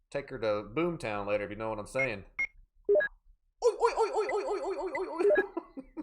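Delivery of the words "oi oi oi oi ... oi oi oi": high, laughing British "oi" chant. Pretend. Streamer cracking up chat, high British oi laughter. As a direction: cackle out oi oi in a British way. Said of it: "he's laughing in British".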